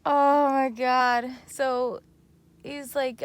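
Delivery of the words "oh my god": disbelief. Real, not acted. Recalling the blinding flashlight.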